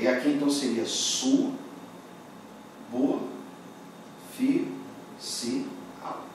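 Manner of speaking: sub, bo, fi, si, al said slowly, one syllable at a time; syllables split apart. Shown as a demonstration. Teacher spelling out sub, bo, fi, si, al syllable by syllable. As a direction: break subsidiar into its separate syllables.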